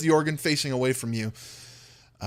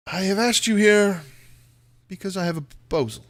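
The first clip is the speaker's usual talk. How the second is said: higher, deliberate formal count; stately villainous host; Strahd proposing; 7 semitones higher, 3 dB louder than usual. Acted. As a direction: announce it formally, with a long pause. GM as Strahd makes his proposal.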